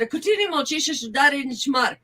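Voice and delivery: quick, mean-sounding Bulgarian; brisk retold Bulgarian warning. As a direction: rattle off a threat in Bulgarian. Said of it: "that sounded pretty mean".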